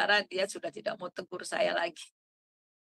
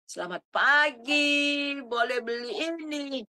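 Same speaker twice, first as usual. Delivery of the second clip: forced bright greeting; 6 semitones higher, 5 dB louder, slower than usual. Retold or imitated. Forced greeting with heavy heart.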